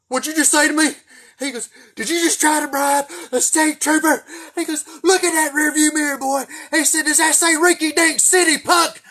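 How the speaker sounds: high, loud, furious shouting trooper; furious trooper yell. Retold or imitated. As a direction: bark it like an enraged trooper scolding a punk. Trooper yells at teen.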